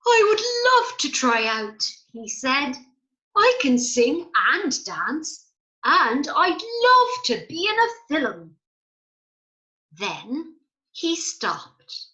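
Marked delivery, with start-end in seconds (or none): 0.0-1.8 put-on high, bright eager child voice; squeaky excited storybook character; excited Gigi. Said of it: "Gigi was very excited". 3.4-8.6 louder, lifting eagerly at the end; pleading to be picked; keen storybook voice; eager Gigi. Acted. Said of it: "Gigi was very excited".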